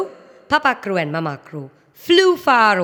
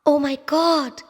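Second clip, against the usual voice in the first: high, loud, panicked cry for her babies; a mother searching in despair; distraught mother; 12 semitones higher, 4 dB louder than usual. Acted. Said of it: "She started weeping".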